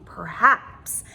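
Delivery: usual speaking voice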